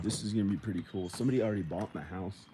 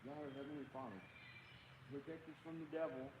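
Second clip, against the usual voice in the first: high, hushed pleading prayer; small, scared-sounding pretend plea; mock frightened prayer; 5 semitones higher, 14 dB quieter than usual. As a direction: pray softly in pretend fear, pitched up. Mock-frightened prayer against the devil.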